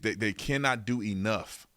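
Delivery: normal talking voice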